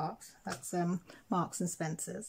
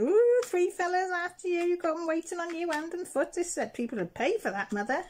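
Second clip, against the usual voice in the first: much higher, louder, playful sing-song tease; teasing; 12 semitones higher, 5 dB louder than usual. Retold or imitated. Daughter teasing her mum about three fellas, playfully. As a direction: rib her like a cheeky daughter. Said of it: "So I was teasing".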